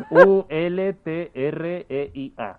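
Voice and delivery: slow letters: U L T R E I A; spelled out letter by letter. Shown as a demonstration. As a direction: spell U L T R E I A slowly, one letter at a time. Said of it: "deletréalo". (deletreando U L T R E I A despacio, letra a letra)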